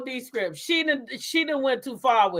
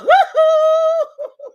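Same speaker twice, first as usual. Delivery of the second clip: shrieking high laugh, much louder; 8 dB louder than usual. Real, not acted. Laughing at the show.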